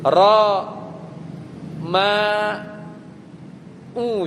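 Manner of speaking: Ro, ma, u split into slow separate beats; syllable by syllable. Shown as a demonstration. Teacher sounding out Ro ma u for students, syllable by syllable. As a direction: spell out Ro, ma, u one beat at a time. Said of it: "Ya-nya antum ganti alif bisa baca nggak?".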